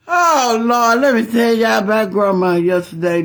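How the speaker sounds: loud, bursting to tell the story; can't wait to spill it; loud excited call to the viewers. Real, not acted.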